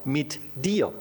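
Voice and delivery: "mit dir" set apart, each word weighted; stress on MIT DIR. Shown as a demonstration. Preacher shows congregation where the psalm's weight lies, stressed MIT DIR. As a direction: lean slowly and firmly on "mit dir". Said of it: "liegt die Betonung auf".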